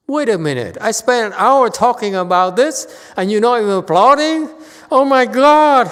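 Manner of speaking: loud, high-pitched incredulous yell; hammed-up indignation building to a shriek; mock outrage. Speaker berates audience for not applauding, in mock outrage.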